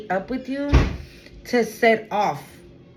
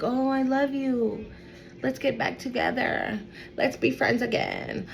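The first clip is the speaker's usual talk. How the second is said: mock sweet-talk; 3 semitones higher, 6 dB quieter than usual. Voicing ex wheedling back.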